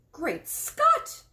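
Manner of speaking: a loud, high exclamation of alarm; shocked cry. A narrator's reading. The queen reads the shocking headline, crying out loud. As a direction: blurt it out at the headline. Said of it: "cried the famous voice".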